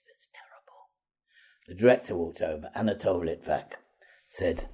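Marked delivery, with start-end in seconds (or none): 0.1-0.9 barely audible, voiceless verdict; imitating a stunned critic under the breath; appalled whisper. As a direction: whisper it as if aghast. Director quietly condemns the attempt.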